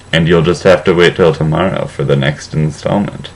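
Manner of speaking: usual speaking voice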